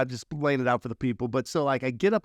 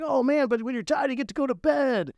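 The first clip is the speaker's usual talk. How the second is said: [name]'s pitch; 10 semitones higher than usual. Retold or imitated. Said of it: "He's like".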